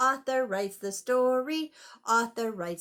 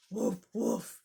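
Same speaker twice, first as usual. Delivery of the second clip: dog bark; 6 semitones lower than usual. Shown as a demonstration. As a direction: show kids a dog bark. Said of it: "Can you sound like Biscuit".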